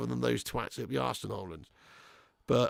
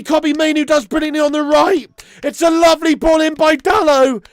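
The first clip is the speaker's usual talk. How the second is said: high, loud, jubilant shouting; euphoric goal rant; 11 semitones higher, 13 dB louder than usual. Real, not acted. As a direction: yell the goal praise as loud as you can.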